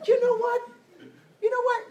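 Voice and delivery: squeaky hammed-up agreeing friend; high-pitched imitation. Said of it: "And now she goes".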